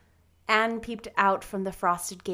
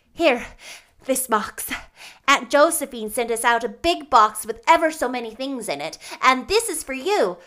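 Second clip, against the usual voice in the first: loud, lightly voiced eager friend; breathless; 4 semitones higher, 9 dB louder than usual. A narrator's reading. Diana explains Aunt Josephine's box, eagerly breathless. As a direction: tumble it out, a touch excited. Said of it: "said Diana breathlessly".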